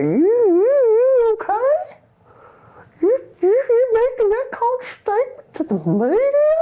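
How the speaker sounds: mock flustered preacher; alarmed pastor. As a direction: sputter the questions in disbelief. Voicing pastor's alarmed question.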